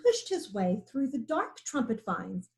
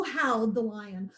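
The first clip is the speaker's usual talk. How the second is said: plain reading voice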